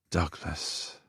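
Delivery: soft breathy whisper of a name. A narrator's reading. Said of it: "he whispered".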